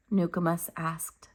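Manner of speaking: plain reading voice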